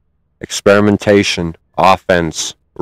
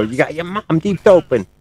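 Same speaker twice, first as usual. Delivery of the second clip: chipmunk voice; 9 semitones higher than usual. Retold or imitated. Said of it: "[name] just fucking go".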